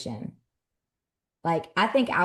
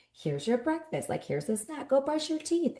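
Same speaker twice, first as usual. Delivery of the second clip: higher bright morning-mom voice; mock cheery parent, much higher; chipper mom; 10 semitones higher than usual. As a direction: start the day sweetly upbeat.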